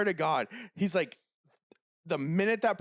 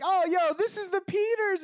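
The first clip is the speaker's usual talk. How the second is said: high, louder happy announcement; sweetest-guy delight; 4 dB louder than usual. Retold or imitated. Imitating coworker spotting a longtime customer, delighted. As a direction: imitate thrilled recognition of regulars. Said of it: "the sweetest fucking guy".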